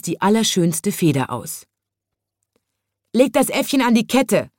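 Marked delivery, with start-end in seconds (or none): 3.1-4.5 higher, louder demand; the annoyed zoo animals demand it. A narrator's reading. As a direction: insist hard, fed up with the monkey. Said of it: "forderten die Tiere".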